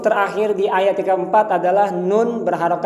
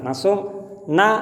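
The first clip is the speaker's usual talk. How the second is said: normal talking voice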